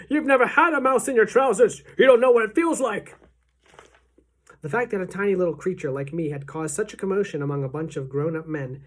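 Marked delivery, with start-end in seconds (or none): 0.1-3.2 high, loud protest; aggrieved cook yelling at high pitch; indignant shouting back. A narrator's reading. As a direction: holler back at the mockers. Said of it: "the man was shouting back".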